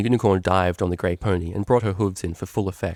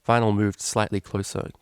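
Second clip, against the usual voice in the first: ordinary delivery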